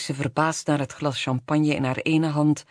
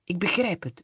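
ordinary delivery, nothing marked

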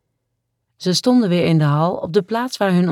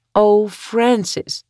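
drawn-out, higher-pitched moan; 5 semitones higher, 3 dB louder, much slower than usual. A narrator's reading. Woman moaning her lover's name in bed. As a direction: moan the name slowly, falling away. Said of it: "kreunde ze".

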